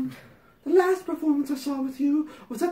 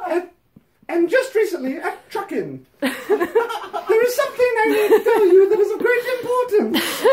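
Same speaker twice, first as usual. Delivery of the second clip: hammed-up letter voice; 5 semitones higher, 6 dB louder than usual. Reading the letter to Patty, hammed-up prim voice. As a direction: read it like a sanctimonious fan pouring her heart out. Reads fan letter aloud.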